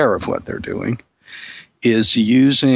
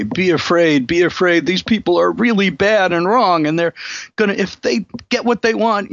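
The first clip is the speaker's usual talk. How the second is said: higher, urgent imitation of a scaremongering anchor; mock a TV anchor telling viewers to be afraid; fearmongering newscaster; 8 semitones higher than usual. TV news anchor warning viewers about bad people, high mock alarm. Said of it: "You know the tone of voice".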